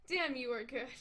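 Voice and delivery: normal talking voice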